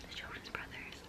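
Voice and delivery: breathless excited whisper. Real, not acted. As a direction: hush it, bursting with excitement. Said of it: "I have to whisper it".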